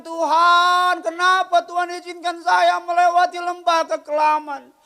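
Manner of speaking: loud, high cry of why Lord; anguished preaching. Retold or imitated. Pastor retelling his prayer to God, loud lament.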